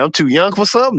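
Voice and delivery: put-on amused disbelief at being called young; surprised. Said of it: "That made me feel a little good".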